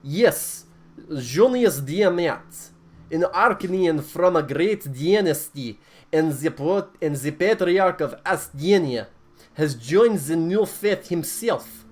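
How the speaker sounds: put-on booming Russian accent sermon. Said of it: "I'm gonna do my Russian accent".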